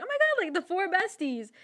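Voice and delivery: high, gushing schoolgirl squeal; mock gushing. Classmates cheering the four besties, high gushing. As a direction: gush like excited classmates. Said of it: "And everyone was like".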